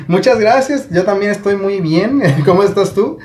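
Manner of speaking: saludo agudo de falsa amabilidad; cortesía fingida. Retold or imitated. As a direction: responde con amabilidad exagerada, conteniendo la risa. Saludo cortés fingido como réplica. (falsa amabilidad, voz aguda)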